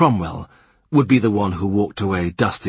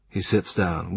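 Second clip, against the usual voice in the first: ordinary delivery, nothing marked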